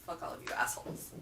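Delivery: whispered swear so it isn't out loud; whispering the rude note from her reading; whispered curse. Real, not acted. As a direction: hiss the curse under her breath. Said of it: "I'm not gonna say it out loud".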